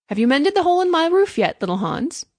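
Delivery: bright-pitched, loud cheerful question; cheerfully calling out a question; happy cry. A narrator's reading. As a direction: call it up happily, high and loud. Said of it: "cried the miller happily".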